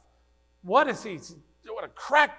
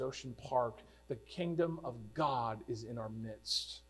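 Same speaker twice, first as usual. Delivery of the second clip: ordinary delivery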